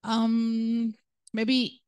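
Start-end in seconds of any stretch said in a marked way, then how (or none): none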